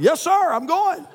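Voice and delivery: high mock-obedient reply; hammed-up yes sir; eager soldier. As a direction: snap to like a recruit. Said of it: "I'm like".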